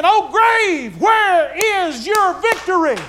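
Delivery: high, slow shout of victory; triumphant cry. A narrator's reading. Believers rising taunt the grave, slow triumphant shout. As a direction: taunt the grave, loud and drawn out. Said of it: "we'll go up singing".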